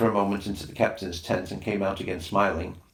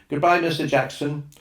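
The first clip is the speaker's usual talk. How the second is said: calling goodbye; 7 semitones higher, 3 dB louder than usual. Acted. Children calling after the boat.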